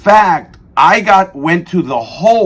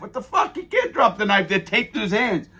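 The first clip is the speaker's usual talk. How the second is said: laughing; 8 semitones higher, 7 dB quieter than usual. Retold or imitated. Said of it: "And we're laughing".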